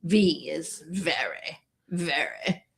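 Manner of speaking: put-on a light, soft English accent. After watching Emma, host reads flood zones in a light English accent. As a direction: whisper it like a Jane Austen lady. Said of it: "doing it in an accent".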